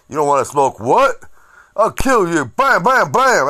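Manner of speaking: loud, high mock thug; tough guy threat. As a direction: bark it like a brawler. Acting burly tough guy.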